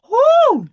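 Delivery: loud, high whooping cheer; cheering woo. Real, not acted. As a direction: let out a big excited whoop. Cheering before the next match.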